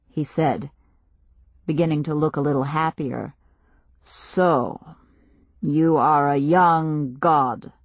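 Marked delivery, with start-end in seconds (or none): 4.0-7.7 slow, grave stranger voice; slow solemn chief. Acted. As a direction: speak slowly like a village elder.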